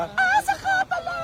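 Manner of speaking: high cracked squeal on "Paasa"; squeaky falsetto. Acted. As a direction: shoot way up into falsetto on "Paasa ka pala".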